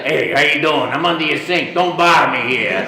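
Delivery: loud, rough-edged Brooklyn plumber; Brooklyn Italian plumber. Shown as a demonstration. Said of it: "Italian plumber from Brooklyn, what is that voice like?".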